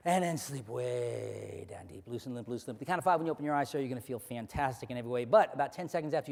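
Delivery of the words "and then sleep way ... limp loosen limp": slow, then low and much softer; hypnotic induction. Acted. Hypnotist sending a volunteer deep, fading drone. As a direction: fade them down into sleep.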